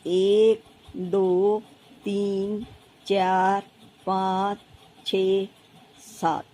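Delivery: low, drawn-out count, one number at a time; low monotone count with gaps; counting aloud. Acted. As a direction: count along slowly as you draw, pausing after each. Acts child counting while drawing stars.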